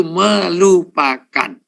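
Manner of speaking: slow, stretched MELUPAKAN; drawn-out emphasis. Real, not acted. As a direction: draw out each syllable of melupakan. Lists hardest step, stretched word.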